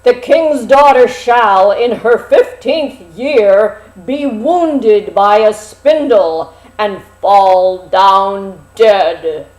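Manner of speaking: loud, slow, menacing curse; loud wicked fairy's curse, drawn out; furious cried-out curse. Acted. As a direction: hurl the curse at the king and queen. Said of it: "she cried out".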